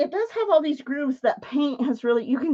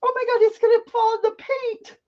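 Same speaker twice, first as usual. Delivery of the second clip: high-pitched panicked cry; real alarm; 11 semitones higher, faster than usual. Real, not acted. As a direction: squeal in alarm as disaster looms. Spider about to fall in paint.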